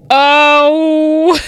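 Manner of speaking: long, loud, high "oh"; emotional wail. Real, not acted. Fan overcome just naming a beloved show, high loud wail. Overcome recalling Schitt's Creek.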